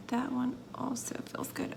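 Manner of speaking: tense careful whisper; concentrating whisper. Real, not acted. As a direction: whisper while holding steady. Careful placing embeds.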